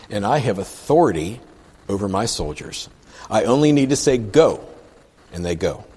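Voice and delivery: emphatic read-aloud, key words leaned on; scripture reading. Acted. Preacher reads the leper's plea to the congregation, scripture reading. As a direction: read the verse out deliberately.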